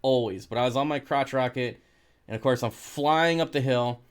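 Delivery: ordinary delivery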